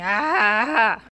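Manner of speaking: loud frustrated cry; frustrated yell. Real, not acted. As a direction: let out an exasperated shout. Everything ruining the recording.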